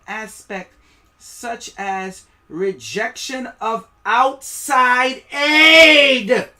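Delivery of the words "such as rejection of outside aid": slow then loud, high on aid; building emphasis. Real, not acted. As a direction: drive the point home.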